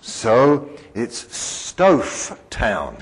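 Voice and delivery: Stouff Town said very slowly, each word set apart; a slow, deliberate Stouff Town; slow explanation. Shown as a demonstration. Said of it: "Ville is French for town".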